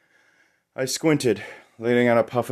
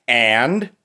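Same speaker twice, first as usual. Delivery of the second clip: louder and blunt, jealousy held under; one clipped demand, anger kept down; direct, held-back jealousy; 5 semitones higher, 8 dB louder than usual. Acted. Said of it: "She was direct, holding back a seething jealousy".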